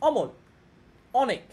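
অমল, অনেক with an open, plain অ; open o sound. Shown as a demonstration. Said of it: "স্বাভাবিক উচ্চারণ".

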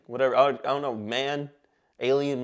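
ordinary talk, nothing marked

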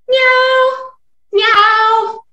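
high, loud playful meow; cat meow. Acted. Teacher meowing like a cat for children, high and loud.

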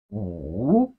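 "wu" dipping very low, then swooping up; falling-rising tone. Shown as a demonstration. Teacher models Chinese five for learners, dipping-rising "wu". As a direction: draw out "wu" from a low dip to a rise. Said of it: "It has the falling rising tone".

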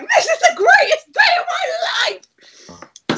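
mimicked high squealing child; overjoyed daughter. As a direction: shriek it like a child in ecstasy, voice giving out. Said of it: "what my daughter likes to do".

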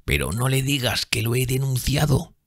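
soft, high, frightened whimper; moaning plea. A narrator's reading. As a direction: beg in fear. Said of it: "gimió".

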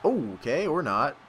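ordinary talk

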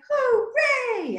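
hooray leaps high; cheering hooray. Shown as a demonstration. Song shout hooray.

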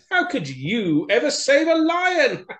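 high, loud jeering mice; mocking laughter. A narrator's reading. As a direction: scoff in a squeaky voice, ending in a laugh. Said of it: "they laughed".

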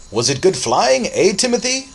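high, booming jovial uncle; hearty loud question. A narrator's reading. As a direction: boom it out to an exhilarated nephew. Uncle hearty after tossing boy.

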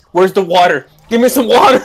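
building cry for help; mock desperate plea. Retelling his panic over the cartoon kidneys, mock desperate.

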